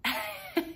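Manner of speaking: high embarrassed laugh. Real, not acted.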